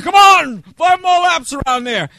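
high, loud barked order to run laps; hammed-up screaming drill instructor; drill instructor yelling. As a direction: bellow it across the field like a drill sergeant. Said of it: "he could scream like nobody".

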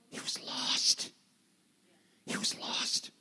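slow, grave whisper; hushed, breath-only repetition. Real, not acted. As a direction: drop to a whisper for emphasis. Grave revelation repeated in whisper.

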